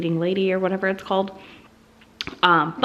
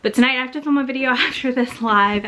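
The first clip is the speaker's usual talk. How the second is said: normal talking voice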